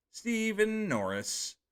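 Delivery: normal talking voice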